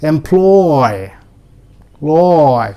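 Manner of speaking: employ with a long oy at the end; employ drilled with a long oy; long oy sound. Shown as a demonstration. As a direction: draw out the oy in employ. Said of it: "वो sound लंबा होगा".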